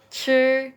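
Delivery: "Sure" as Mandarin zhi, held high and flat; slow demo of "Sure" on one level tone; "Sure" stretched out evenly, tongue curled; level first-tone zhi. Shown as a demonstration. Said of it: "dia nada satu jadi datar".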